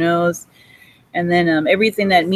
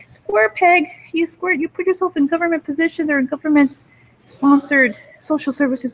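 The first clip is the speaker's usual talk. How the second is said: high, pitched-up mocking address; mock taunting; 9 semitones higher than usual. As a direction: jeer at them in a high put-on voice. Acted taunt at an imagined target.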